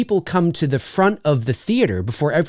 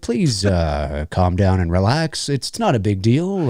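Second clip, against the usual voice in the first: mock reassuring; 7 semitones lower, slower than usual. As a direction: lower calm reassurance. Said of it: "I'd be like".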